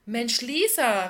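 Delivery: loud, drawn-out delighted call; erfreuter Ausruf. A narrator's reading. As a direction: call out in happy surprise at an old friend. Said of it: "rief die Frau erfreut". (freudig überraschter, gedehnter Ausruf)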